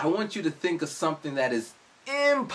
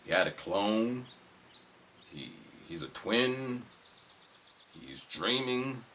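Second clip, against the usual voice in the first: puzzled guessing; 4 semitones lower, 5 dB quieter, slower than usual. Retold or imitated. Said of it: "scratching their heads thinking".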